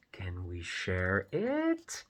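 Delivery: slow question with "it" lifting upward. Acted. Gerald has a question, slow asking rise on "it". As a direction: stretch it and raise "it" at the end. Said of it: "Gerald has a question".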